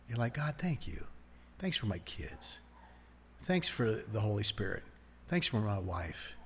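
very soft, low bedside thanks; hushed prayer. Acted. Believer thanking God beside a sleeping spouse, hushed prayer. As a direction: murmur gratitude so as not to wake anyone.

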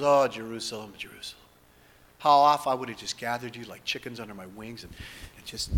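soft, sorrowful lament fading to near silence; grieving lament. Acted. Preacher voicing Jesus weeping over Jerusalem, hushed and grieving. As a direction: mourn over the city, quietly. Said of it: "he goes".

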